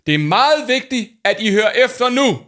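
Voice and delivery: loud, slowed-down alarmed announcement; Paul shouting. Acted. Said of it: "råbte han".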